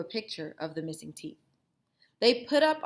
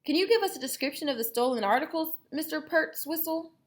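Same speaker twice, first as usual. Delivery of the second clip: officer; 9 semitones higher, 5 dB louder, faster than usual. Acted. Officer asking.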